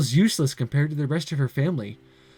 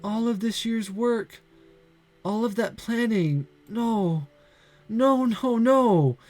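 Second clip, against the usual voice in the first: put-on despairing girl Santa; 7 semitones higher, slower than usual. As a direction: let her hope drain away word by word. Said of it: "looking in despair at the pile".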